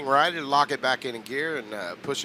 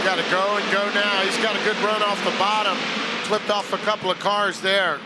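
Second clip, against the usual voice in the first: higher, louder rush of play-by-play; excited race call; 7 semitones higher, 3 dB louder than usual. Real, not acted. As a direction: push the call as the car charges. Caller reacts to restart.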